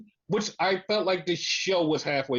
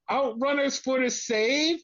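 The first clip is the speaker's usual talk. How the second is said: higher, slow mock commentator call; imitating Tony Schiavone; 6 semitones higher than usual. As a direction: imitate a commentator announcing a rescue, drawn out. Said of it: "then Tony Schiavone goes".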